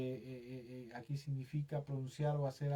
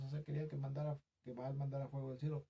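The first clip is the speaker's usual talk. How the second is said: normal talking voice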